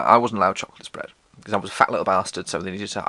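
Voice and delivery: usual speaking voice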